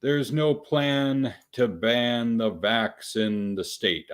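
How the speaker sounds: laboriously slow, stretched phrasing; slow, deliberate explaining. Real, not acted. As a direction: spell it out slowly so everyone gets it. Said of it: "I have to say this slowly".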